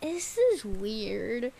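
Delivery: ordinary talk, nothing marked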